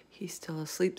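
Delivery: soft, hushed whisper. Acted. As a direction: whisper it so the sleeper won't hear. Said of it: "the B F G whispered".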